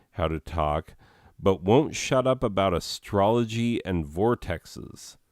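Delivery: ordinary talk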